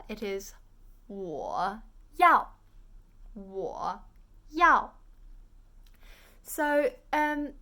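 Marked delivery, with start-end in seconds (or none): 1.1-4.9 low "wo", then a loud falling "yao"; third tone, fourth tone. Shown as a demonstration. Teacher puts "I want" together, third then fourth tone. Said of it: "Now put it together".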